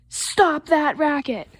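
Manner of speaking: loud, slow, emphatic order; storybook parental holler; yelling dad. Acted. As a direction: shout it as a command, word by word. Dad yells about the noise.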